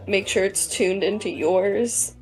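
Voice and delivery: ordinary talk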